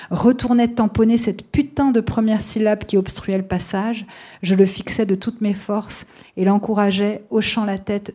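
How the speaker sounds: steady, firm reading of a novel passage; author's public reading voice; literary reading. A narrator's reading. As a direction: read the story out to the audience. Author reads her novel aloud.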